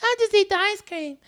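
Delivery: high, soft mocking voice; mocking wife. [name] mimicking his wife's remark, high mocking voice. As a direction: squeak it out like a prissy wife.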